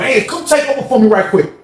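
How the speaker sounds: high, urgent call; calling his homeboy. Retold or imitated. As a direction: ask a friend over in a hurry. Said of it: "He called his homeboy".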